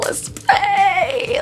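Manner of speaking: high, stretched-out "pray"; singsong, long-held hopeful cry; playful drawn-out plea. Real, not acted. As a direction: let the word soar up high and hang there. Playful drawn-out chant, hoping to win.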